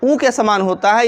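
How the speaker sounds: usual speaking voice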